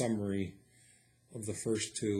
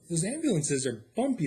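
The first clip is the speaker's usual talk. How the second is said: ordinary delivery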